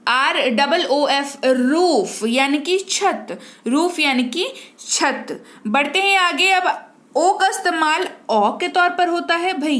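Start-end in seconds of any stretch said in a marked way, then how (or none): none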